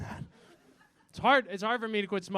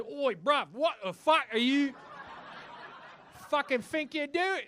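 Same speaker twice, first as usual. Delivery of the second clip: put-on higher-pitched British street accent drifting Australian; indignant "oi bruv" accent, sliding toward Australian; London accent slipping Australian; 6 semitones higher, slower than usual. Child confronting whoever hit him, botched London-Australian accent. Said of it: "Just to immediately turn Australian".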